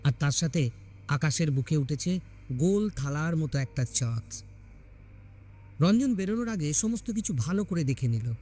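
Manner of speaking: ordinary delivery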